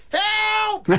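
very high imitation of a toddler's yell. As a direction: squeal it out like a two-year-old. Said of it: "he's yelling out".

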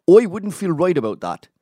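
Luton accent. Shown as a demonstration. Mocking Tommy's Luton mates, Luton "Oi" accent. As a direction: lean into the Luton "Oi wouldn't". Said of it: "they say oi instead of I".

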